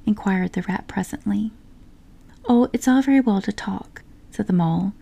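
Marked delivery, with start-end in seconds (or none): 2.5-4.0 higher-pitched peevish retort; a touch of petulance; pettish. A narrator's reading. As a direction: answer rather huffily, pitch lifted. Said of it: "rather pettishly".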